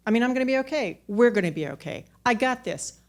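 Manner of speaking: talking herself into being okay; forced brave front. Real, not acted. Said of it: "I don't have this".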